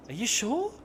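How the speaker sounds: high, rising, doubtful question; mock disbelief. Mock doubt about the famous building.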